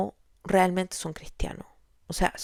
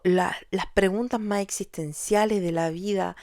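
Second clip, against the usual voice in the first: ordinary delivery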